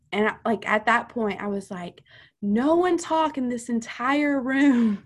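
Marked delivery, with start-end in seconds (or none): 2.4-5.0 overwhelmed demand. Retold or imitated. Retold overwhelmed demand for silence.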